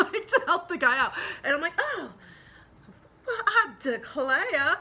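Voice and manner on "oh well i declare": high, loud, slow mock-offended belle gasp; mock Southern belle. Retelling the semi crash, a laughing mock-offended Southern belle. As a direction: play the prim lady clutching her pearls, laughing through it.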